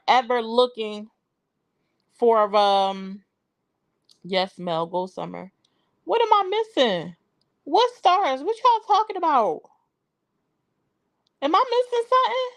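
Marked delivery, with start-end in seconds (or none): 6.0-12.5 baffled. Real, not acted. Confused by chat about stars.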